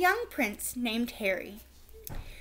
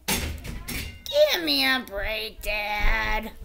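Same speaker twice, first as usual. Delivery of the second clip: groan; 2 semitones lower, much slower than usual. A narrator's reading. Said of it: "groaned Harry".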